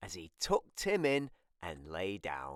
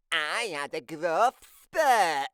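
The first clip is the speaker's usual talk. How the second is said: higher, louder little-brother voice; Tim's complaint; 6 semitones higher, 6 dB louder than usual. Acted. Tim complaining he was not told, higher and louder. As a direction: whine it like a left-out kid.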